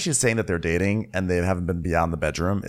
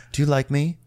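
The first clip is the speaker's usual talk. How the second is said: ordinary talk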